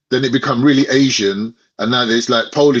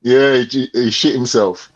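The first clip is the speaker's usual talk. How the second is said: normal talking voice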